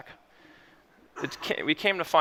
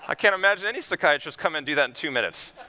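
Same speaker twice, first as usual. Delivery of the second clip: ordinary talk, nothing marked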